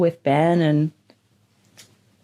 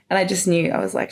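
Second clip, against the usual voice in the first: ordinary talk, nothing marked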